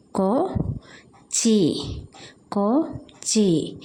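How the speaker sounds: slow, syllables apart. Shown as a demonstration. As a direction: sound it out slowly for the class. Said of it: "syllable by syllable for children". (പതുക്കെ അക്ഷരം തിരിച്ച്)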